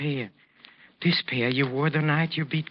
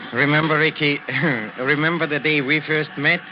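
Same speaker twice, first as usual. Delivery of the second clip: loud, with chuckles breaking in; laughing reminiscence; 2 semitones higher, 5 dB louder than usual. Acted. Maestro reminiscing to Ricky in the steam room, laughing. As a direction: laugh between the phrases, voice raised.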